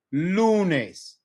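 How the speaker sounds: "lunes" drawn out slowly on LU; front stress. Shown as a demonstration. Teacher showing the stress rule, LU in "lunes" long and strong. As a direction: stretch and stress the first syllable of "lunes". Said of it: "more emphasis on the front end".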